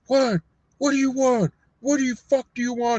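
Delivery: high, irritated guard snapping; annoyed guard. Retold or imitated. Guard snaps at prisoner banging on door, irritated and high.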